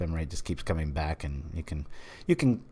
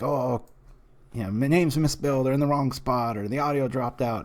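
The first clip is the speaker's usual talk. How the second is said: higher-pitched imitation of nitpickers; mock haters' complaint; 6 semitones higher than usual. Streamer imitating haters of the league, in a higher mocking complaint. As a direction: mimic petty complainers, pitched up. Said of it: "there's some haters, you know, saying, like".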